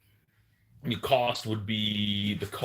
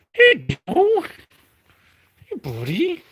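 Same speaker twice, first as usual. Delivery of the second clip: talking to a dog; 9 dB louder, slower than usual. Real, not acted. Greeting the dog.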